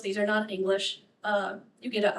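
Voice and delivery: ordinary talk, nothing marked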